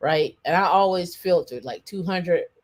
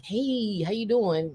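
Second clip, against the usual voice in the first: lilting put-on hello, starting higher; teasing greeting voice; 3 semitones higher, 4 dB quieter, slower than usual. Shown as a demonstration. Host greets a friend who hates it, teasing greeting voice. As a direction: greet a friend in your signature voice. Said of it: "my voice, like".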